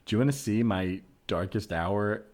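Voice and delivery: normal talking voice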